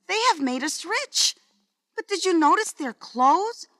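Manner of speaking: storybook wife, gently earnest; shoemaker's wife. Acted.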